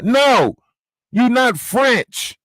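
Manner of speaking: high, slow shouted no, you not French; angry high shout. Real, not acted. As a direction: yell it out slowly, word by word. Shouted denial.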